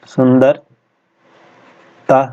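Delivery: "सुंदरता" drawn out very slowly; slow dictation. Shown as a demonstration. Teacher to students, dictating "सुंदरता" slowly. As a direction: stretch "सुंदरता" syllable by syllable for the class. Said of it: "सुंदर का क्या हो जाएगा".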